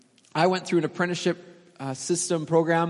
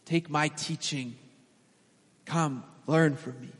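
gentle invitation; 2 semitones lower, 3 dB quieter than usual. Acted. Preacher voicing Jesus to the weary, soft and tender. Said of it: "Jesus is saying".

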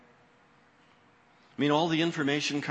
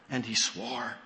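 ordinary delivery

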